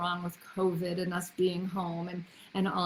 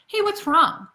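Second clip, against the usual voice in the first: loud, higher, abrupt check-in; confronting question; 7 semitones higher, 8 dB louder than usual. Shown as a demonstration. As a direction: demand to know what the problem is. Models the wrong way to ask.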